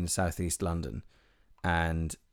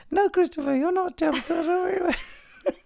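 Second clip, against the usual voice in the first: much higher, cooing voice; high pet talk; 3 dB louder than usual. Real, not acted. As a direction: coo it at a pet. Speaking aside to a pet or child.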